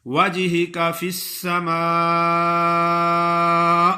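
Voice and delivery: slow, drawn-out tajweed chant; Quranic recitation. Acted. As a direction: recite slowly with long madd.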